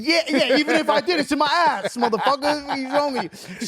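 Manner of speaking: high-pitched, incredulous comeback, half laughing; exaggerated indignant protest, pitched well up; mock exasperation. Comic retelling of answering cops who ask about coke, mock exasperated.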